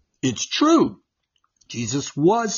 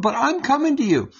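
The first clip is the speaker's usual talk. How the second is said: woman pleading; 11 semitones higher, faster than usual. Retold or imitated. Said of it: "for that woman".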